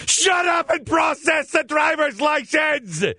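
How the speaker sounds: high, slow, furious shout; exasperated shout. Retold or imitated. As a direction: bark it like you've finally snapped. Inner outburst at clerk.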